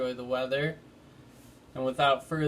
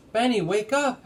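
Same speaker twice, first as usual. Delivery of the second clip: urging; 8 semitones higher, 4 dB louder than usual. A narrator's reading. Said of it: "Henry urged him".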